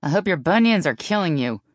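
loud, swooping taunt; loud, needling shout; yelled jibe. A narrator's reading. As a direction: holler the insult after them. Yelled quarrel over the call.

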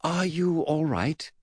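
put-on slow, high boy's voice; Eddie's concerned question. Eddie to his injured great-uncle, high concerned voice.

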